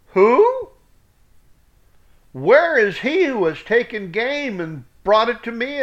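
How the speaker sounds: high, loud cry of alarm that eases into a shaken question; Isaac realizing he was deceived, high and loud with shock; trembling shock. Acted. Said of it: "Trembled ... with great trembling".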